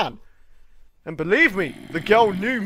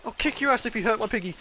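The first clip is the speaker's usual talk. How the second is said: ordinary talk, nothing marked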